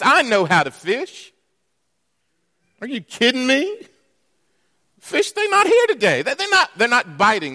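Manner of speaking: a proud fisherman defending his expertise; exasperated rant. Retold or imitated. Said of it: "I can imagine him saying this".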